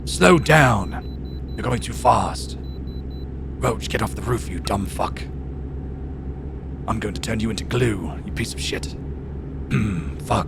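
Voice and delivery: gruff game-character grumbling out directions; terse, grunting imitation of a game voice; Geralt impression. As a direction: imitate a fed-up monster hunter cursing his horse. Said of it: "he literally is like".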